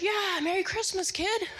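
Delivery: high-pitched hearty call-back; imitated parade onlooker. One guy answering the boy, imitated high shout-back. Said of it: "And one guy goes".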